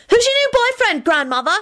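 high-pitched, curious youngster voice; interested grandchild. A narrator's reading. Grandchild asking about grandmother's new boyfriend, high and interested. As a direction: pipe up with a keen question in a high voice. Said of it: "Timothy asked her interestedly".

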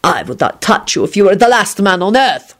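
controlled, venomous loathing; malevolent hiss. A narrator's reading. Radu rejecting his cellmate, malevolent. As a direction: spit it out with restrained malice. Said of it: "Radu hissed malevolently".

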